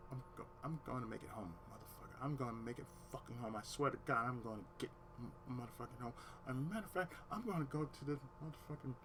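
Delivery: hushed, low muttering to yourself; seething thoughts kept under the breath; inner monologue. Retold or imitated. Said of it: "back of my head's like".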